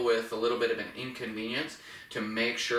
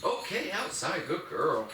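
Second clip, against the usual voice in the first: "outside" set apart and pushed, pitched up; emphasis on outside; 5 semitones higher, slower than usual. Shown as a demonstration. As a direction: stress "outside" in a higher-pitched cue to the dog. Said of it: "I'm gonna emphasize the word outside".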